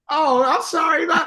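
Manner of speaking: high, loud, startled apology; a caught-off-guard stranger's loud apology; stranger's reply. Retold or imitated. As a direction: blurt it out high and surprised. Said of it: "They'll go".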